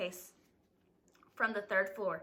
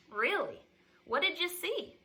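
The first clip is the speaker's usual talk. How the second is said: curious question, lifting high at the end; full of curiosity; 7 semitones higher than usual. A narrator's reading. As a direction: ask eagerly, pitch climbing. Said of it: "full of curiosity".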